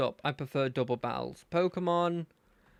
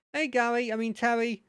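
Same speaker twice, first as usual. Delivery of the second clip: higher-pitched friendly lad; nice-ish character voice; 7 semitones higher, 3 dB louder than usual. Acted. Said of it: "give him a nice-ish voice".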